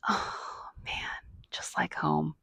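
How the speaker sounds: a whisper to herself over a candle that smells like Arizona; nostalgic whisper. Retold or imitated. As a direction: breathe it out like you smell home. Sniffing candles, quietly nostalgic.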